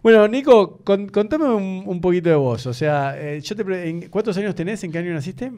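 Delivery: host starting the interview with the guest. Acted.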